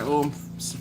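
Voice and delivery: ordinary talk, nothing marked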